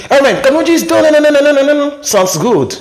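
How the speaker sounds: high casual boss voice; high put-on boss; boss talking. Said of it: "ton patron te dit".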